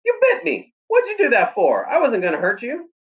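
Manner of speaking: put-on very high, stung boy's outcry; hurt shout. A narrator's reading. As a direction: yelp it like you just got bitten. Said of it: "I shouted".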